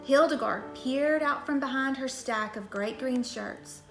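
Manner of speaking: ordinary delivery, nothing marked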